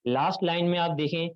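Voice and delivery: ordinary delivery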